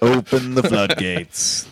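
slow, grand announcement; grand proclamation. Pretend. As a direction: proclaim it like a decree. Repeats quote grandly.

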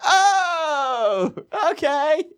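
high "oh, okay" of a clerk; mock sudden understanding. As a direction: imitate a clerk who finally gets it. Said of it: "they're like".

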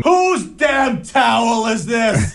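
high, drawn-out mock shout; hammed-up, slow, high-pitched yell; yelling dad impression. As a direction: holler like an outraged dad from another room. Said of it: "I love doing an impression of you".

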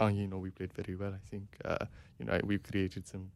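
a badly faked French manager accent, trailing away; terrible French accent. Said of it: "that's a terrible French accent".

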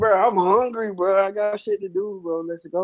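high whiny complaining. Real, not acted. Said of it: "You sound like a big baby".

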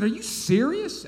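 high, loud, drawn-out incredulous question; indignant disbelief. Retold or imitated. Young pastor challenging a complainer across the desk, indignant disbelief. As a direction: stretch it out in high-pitched outrage. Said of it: "I said it just like that".

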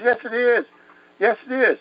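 high-pitched retold protest; insistent boy. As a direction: insist like a scared child arguing back. Boy insisting to his uncle.

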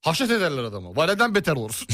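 ordinary delivery

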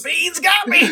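high, loud hammed-up dying groan; mock death cry. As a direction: ham up a victim felled by bad beans. Mock dying from bad beans.

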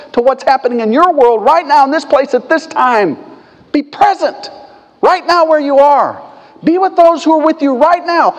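fervent pulpit exhortation; preaching. Acted. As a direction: press it on the congregation. Preaching at higher energy.

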